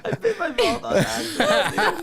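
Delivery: high laughing repeat. Real, not acted. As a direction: giggle through the words. Laughing at his own slip.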